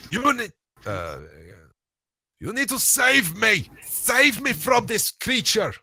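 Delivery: high, loud, shrieking plea for help; panicked screaming. Acted. Igor screams to the square while dragged off, terrified. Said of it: "Igor starts kicking and screaming".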